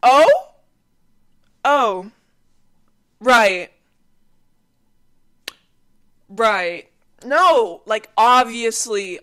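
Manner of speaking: high, loud sarcastic sweetness; mock apology. Mocking apology to the guy who expected sex. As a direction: overplay the apology to mock him.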